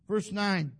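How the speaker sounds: ordinary delivery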